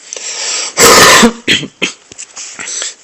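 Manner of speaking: harsh rasping cough from a real cold; real coughing fit. Real, not acted. A sick gamer coughs mid-tutorial to his viewers, real harsh cough. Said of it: "Désolé, je suis un peu malade".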